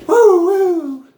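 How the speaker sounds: very high, loud falsetto whoops; playful high woo-woo. Pretend. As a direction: hoot it out high like a siren. Goofy vocal noises.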